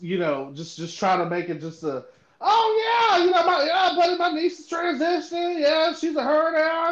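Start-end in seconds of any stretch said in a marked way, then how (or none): 2.4-6.9 much higher, hammed-up good-old-boy voice; mock the awkward supportive Bubba; Bubba impression. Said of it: "I love when Bubba tries to just".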